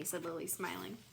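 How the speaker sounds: ordinary delivery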